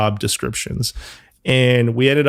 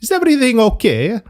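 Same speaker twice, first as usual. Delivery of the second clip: put-on high-pitched, thick Italian accent; strong Italian accent; 13 semitones higher than usual. Former colleague from Naples checking he is sane, strong Italian accent. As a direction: mimic a worried Neapolitan with a strong accent. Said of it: "I'll do his accent".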